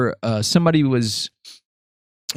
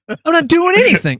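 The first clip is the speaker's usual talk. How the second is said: put-on high, louder guilty-kid voice; mock innocent denial; 5 dB louder than usual. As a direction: squeak out innocence like a caught teenager. Caught teens deny everything.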